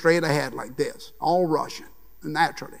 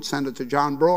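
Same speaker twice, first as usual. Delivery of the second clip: ordinary talk, nothing marked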